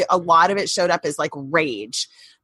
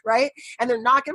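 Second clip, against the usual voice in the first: usual speaking voice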